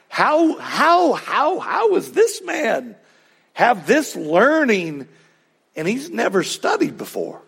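high, stretched-out acted astonishment; astonished disbelief. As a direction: play the leaders stunned, stammering on the repeated question. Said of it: "their jaw is on the floor".

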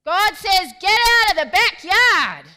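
booming, high-pitched shout; very loud preacher. Retold or imitated. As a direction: belt it out like a loud guest preacher, stretching the words. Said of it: "then he says, very loudly".

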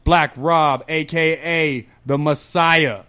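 announcing guests like a wrestling MC; building hype with each nickname; hype host intro. Acted.

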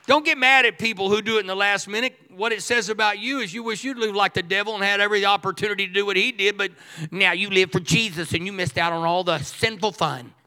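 roaring sermon climax; full-force preaching. Acted. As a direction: lift the room, shouting the good news.

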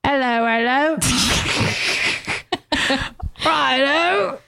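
high, hammed-up British accent; a cheery mock Londoner calling out. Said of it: "The accent that I'm worst at".

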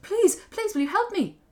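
very high, loud begging; young woman's acted plea; pleading girl. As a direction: beg desperately, pitched right up. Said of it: "And she said".

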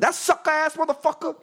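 high, put-on voice full of contempt; scornful old gangster. Old gangster in the gym dismissing [name], mock contemptuous voice. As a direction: spit out the insult like the old-timer.

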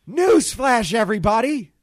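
loud, high proclamation in a put-on voice; Bobby's shouted announcement. Imitating a castmate busting in on gossiping friends, shouted announcement. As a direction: burst in and announce it at full volume. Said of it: "I have to do it with this voice".

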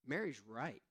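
softly voiced Jesus calling Martha to rest; gentle Jesus. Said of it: "Jesus was like".

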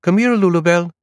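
put-on high, loud call as a small boy. A narrator's reading. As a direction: call the dog back like a seven-year-old boy. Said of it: "He called out".

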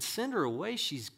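high, complaining plea; acted exasperated disciples begging; imploring. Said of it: "his disciples came and implored him".

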